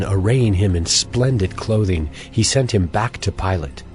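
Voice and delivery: normal talking voice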